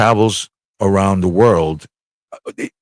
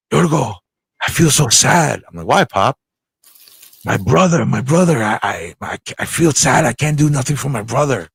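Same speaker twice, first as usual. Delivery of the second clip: a lightly voiced sad old father, a bit higher; imitating sad dad; 4 semitones higher than usual. Son imitating his dying father's sad words, mildly voiced. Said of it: "I'm gonna do it just the way he said it".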